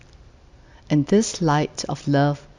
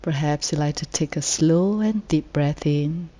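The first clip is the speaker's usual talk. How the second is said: guided meditation; 4 dB quieter than usual. Real, not acted. Meditation leader inviting the group to settle and let go, calm and unhurried. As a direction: gently lead sitters into rest, phrase by phrase.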